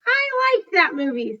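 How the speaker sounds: high, loud gorilla's dog-friend line; mistaken Bob voice. A narrator's reading. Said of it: "I thought it was Bob saying it".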